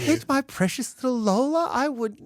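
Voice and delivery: high, laughing fake outrage; mock indignant. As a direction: protest innocence, hamming it up. Dad acting offended, denying hitting.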